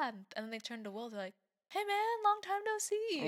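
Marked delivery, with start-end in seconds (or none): 1.7-3.3 high, loud stranger's greeting; mock friendly hail. Strangers in Jamaica greeting her husband as a friend, high mock hail. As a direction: call out like greeting a long-lost friend, drawing out the end.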